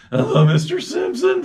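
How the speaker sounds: cartoon teenager impression, high and loud; Simpsons spotty teenager. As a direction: squeak it out like a gawky teenage shop kid. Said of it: "the kid in The Simpsons".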